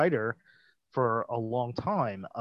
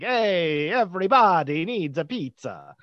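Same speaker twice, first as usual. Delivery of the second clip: hammed-up, sing-song stock Italian accent; 5 semitones higher than usual. Guessing at how Oscar said the line, stock Italian accent. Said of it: "some, like, stock Italian accent".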